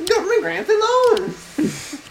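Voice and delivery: very high, slow pitchman cry; infomercial pitchman. Retold or imitated. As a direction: squeal it like a TV huckster. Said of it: "Lesko impression again".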